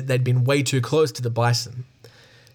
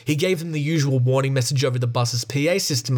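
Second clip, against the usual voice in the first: plain reading voice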